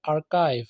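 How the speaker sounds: आर्काइव with a hard K, "ar-kive"; K sound in आर्काइव. Shown as a demonstration. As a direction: say the middle of आर्काइव as K, not CH. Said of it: "के की आवाज़ निकालनी है".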